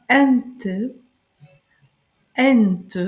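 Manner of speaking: "Ente" with a clear short e, stressed first syllable; German Ente. Shown as a demonstration. Teacher models German Ente for the class. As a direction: say the German word for duck crisply. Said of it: "on le prononce en allemand I".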